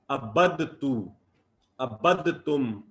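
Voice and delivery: عبدتو and عبدتم said slowly, each د sounded before ت; unassimilated forms. Shown as a demonstration. Teacher shows the forms the pattern predicts, slow and careful. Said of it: "یہ ہونا چاہیے تھا".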